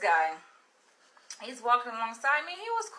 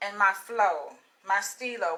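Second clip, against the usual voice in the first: usual speaking voice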